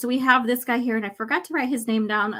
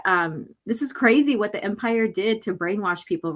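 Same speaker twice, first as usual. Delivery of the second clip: ordinary talk, nothing marked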